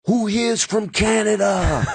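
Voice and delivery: slow, high crowd-rousing call, not full shout; imitating Dice. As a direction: model a hype line for the opener. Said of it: "Go out there and be like".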